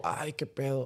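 very hushed mock dismay; susto contenido. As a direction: say it under your breath, as if caught out. Recrea el despertar junto a alguien. (asombro fingido, muy bajito)

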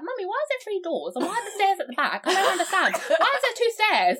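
put-on high, childlike voice; imitating a puzzled little kid; toddler voice. Little toddler asking his mummy, high and puzzled. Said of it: "this little toddler was like to his mummy".

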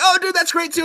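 very high, gushing agreement; chummy enthusiasm. Retold or imitated. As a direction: act out an overjoyed new pal. Enemies bond over jokes.

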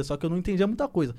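ordinary delivery

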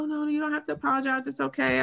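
imitating soothing nurses in a high voice; reassuring nurses. Nurses telling an emotional patient not to apologize, reassuring.

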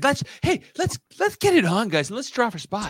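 mock game-show host hype; an excited host calling the draw; hammed-up host impression. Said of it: "he's like".

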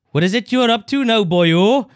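put-on high, loud South Wales accent; Welsh local bobby. Imitating local bobbies questioning a man, hammed-up Welsh accent. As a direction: challenge them in a hammed-up Welsh lilt. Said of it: "all the local bobbies, like".

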